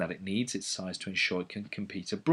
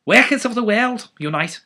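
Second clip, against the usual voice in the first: mock Liverpudlian rally cry; 11 semitones higher, 7 dB louder than usual. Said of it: "has to be said in a Liverpudlian accent".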